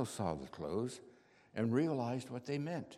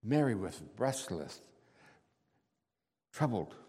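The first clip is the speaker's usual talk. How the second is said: ordinary delivery, nothing marked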